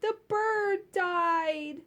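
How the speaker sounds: put-on high, slow, drawn-out little-kid cry; mock wail of a heartbroken kid; hammed-up child grief; child's sad wail. Child crying in story.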